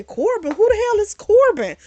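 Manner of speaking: baffled surprise. Retold or imitated. Recapping a show to viewers, suddenly baffled by a new sibling. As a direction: react like you've never heard the name. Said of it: "I say".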